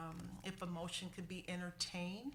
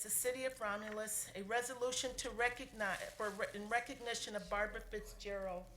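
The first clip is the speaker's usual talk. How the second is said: formal resolution reading; 3 semitones higher, 3 dB louder than usual. Acted. Clerk reading the retirement resolution aloud in a formal voice. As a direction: proclaim each whereas to the room.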